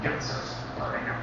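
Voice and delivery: ordinary delivery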